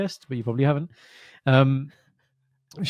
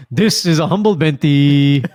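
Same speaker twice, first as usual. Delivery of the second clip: mock pleading; 3 semitones higher, 12 dB louder, slower than usual. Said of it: "preface it with".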